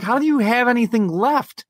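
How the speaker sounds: high-pitched exasperated question; retold incredulity. Owner to endlessly peeing dog, incredulous retold question. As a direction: ask it in comic disbelief.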